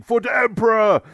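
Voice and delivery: very high, loud war cry; shouted battle cry. A narrator's reading. Orc mob slashing through Ultramarine lines, shouting. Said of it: "mob shouting".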